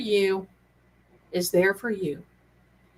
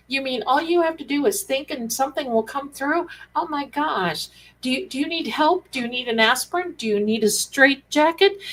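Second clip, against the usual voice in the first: higher mock disbelief; hammed-up scoffing critic; mocking skeptic; 4 semitones higher than usual. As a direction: ham up the nagging doubter. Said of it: "people that don't understand will start".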